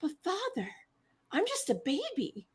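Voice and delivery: mock-innocent baby voice begging father. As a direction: play the innocent little baby. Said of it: "he's like".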